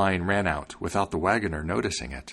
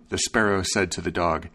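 ordinary delivery, nothing marked

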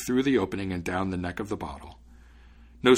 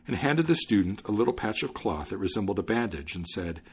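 usual speaking voice

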